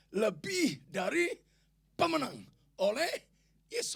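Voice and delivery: loud, slow, high declaration; emphatic proclamation. Real, not acted. Preacher quoting Romans to the congregation, loud proclamation. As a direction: stretch and punch each phrase of the verse.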